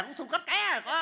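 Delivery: cao vút, to tiếng kiểu hài; la lên dọa dẫm khoa trương. Acted. Hù dọa người chạy xe. (dọa nạt om sòm)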